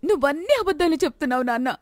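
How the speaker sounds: put-on hurt, accusing young woman; accusing daughter. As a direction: she calls out his lies.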